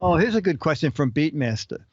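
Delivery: ordinary talk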